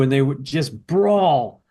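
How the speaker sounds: ordinary talk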